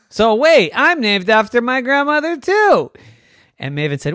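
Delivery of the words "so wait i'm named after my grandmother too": high, loud burst of discovery; excited realization. A narrator's reading. Cave Bear finding common ground with Maven, loud excitement. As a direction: exclaim it way up high.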